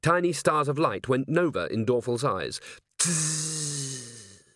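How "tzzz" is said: soft, sustained buzzing hiss; drawn-out electrical fizz, softer than speech; imitated fizz. A narrator's reading. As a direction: voice a long sizzle. Narrator imitates a fizzing noise.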